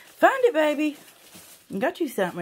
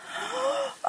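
excited gasp; 11 semitones higher, 8 dB quieter than usual. Real, not acted. Dog finds the toy.

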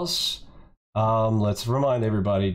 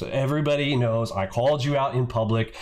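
ordinary delivery

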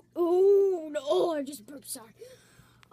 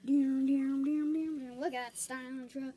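ordinary delivery

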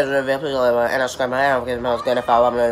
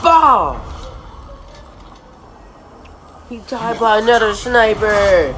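excited shout; 6 semitones higher, 4 dB louder, slower than usual. Real, not acted. Shouting at trailer action.

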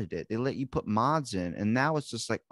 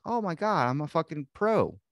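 cocky boast; 7 semitones higher than usual. Pretend. Imagined boast after kissing nobody.

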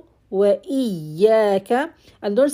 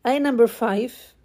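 normal talking voice

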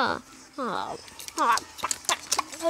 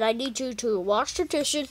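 ordinary talk

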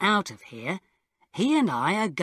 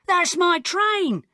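much higher, loud, slow cry; shouted; 12 semitones higher, 6 dB louder, slower than usual. A narrator's reading. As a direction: shout after the departing train. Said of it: "I shouted".

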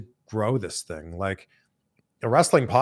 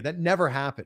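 ordinary delivery

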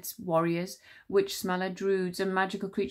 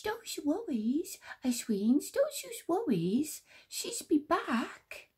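whispered; 9 semitones higher, slower than usual. Acted. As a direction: soothe her gently like a tiny sprite. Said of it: "Squeeze Juice whispered".

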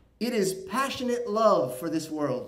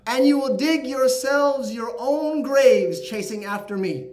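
louder and higher, rising to a near shout; the world mocking the men stuck in the hole; loud warning; 5 semitones higher, 6 dB louder than usual. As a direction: declaim it as a stern warning to the chasers. Dunya scolds her chasers.

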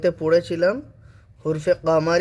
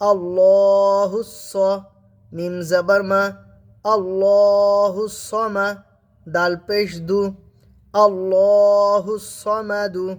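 আল্লাহুস sung slow, the hu joined to the s; slow Quranic recitation; 3 semitones higher, 3 dB louder, much slower than usual. Shown as a demonstration.

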